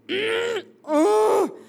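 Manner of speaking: exasperated fisherman, hammed up and emphatic; indignant fisherman. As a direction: play an affronted old hand telling off a landlubber. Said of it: "And Simon says".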